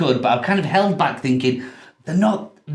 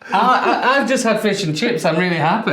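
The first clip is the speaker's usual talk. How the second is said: laughing; 7 semitones higher than usual. Real, not acted. Joking about fish and chips.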